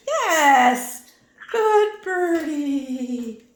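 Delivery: loud, high, slow praise; excited praise. Real, not acted. Owner praising her parrot after a turn, loud slow cheer. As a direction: reward the bird with a big drawn-out cheer.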